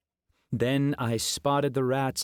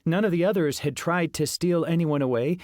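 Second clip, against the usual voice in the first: ordinary delivery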